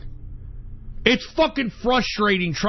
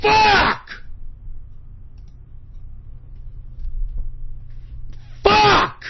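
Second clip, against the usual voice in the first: real uncontrolled fury; enraged shout; 12 semitones higher than usual. Real, not acted. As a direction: scream it with a long gap between. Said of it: "I can't fucking calm down".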